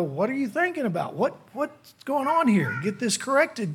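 lightly acted boss walking in on a worker; boss questioning. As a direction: pull them up like a puzzled boss. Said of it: "your boss may walk in and go".

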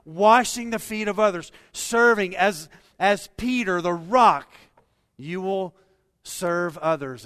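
loud, high, impassioned voice of Jesus; Jesus urging Peter. Acted. Jesus telling Peter how he will live, loud and high. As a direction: plead with Peter at full force.

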